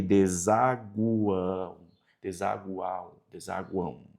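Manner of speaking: desaguão repeated slowly and softly, the U glide stressed; slow, soft pronunciation drill. Shown as a demonstration. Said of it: "ideia do som do U".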